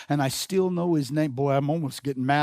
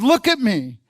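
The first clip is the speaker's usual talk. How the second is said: higher, louder angry comeback; acted-out angry kid, raised voice; mad retort; 7 semitones higher, 7 dB louder than usual. Mad kid insisting to his dad, angry. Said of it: "I was mad".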